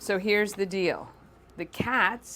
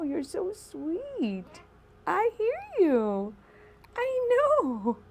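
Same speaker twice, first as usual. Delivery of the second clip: pet talk voice; 13 semitones higher, slower than usual. Real, not acted. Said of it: "my, uh, pet talk voice".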